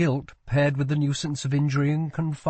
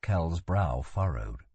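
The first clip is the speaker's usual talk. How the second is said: ordinary delivery, nothing marked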